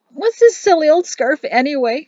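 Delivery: high, loud, tearful woman's voice; weepy, high-pitched wail of a question; blubbering. A narrator's reading. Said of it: "she blubbered".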